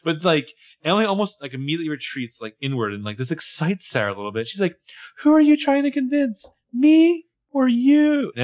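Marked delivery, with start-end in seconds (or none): 5.2-8.3 high, loud, slow theatrical challenge; creepy taunt. Retold or imitated. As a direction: draw each word out in a high, mocking lilt. Acting out creepy Sarah's taunt.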